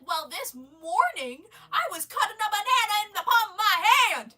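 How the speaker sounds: high, loud over-the-top outburst; hammed-up high-pitched yell; loud mock retort. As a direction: belt it out as an absurd comeback. Mock retort about cutting fruit.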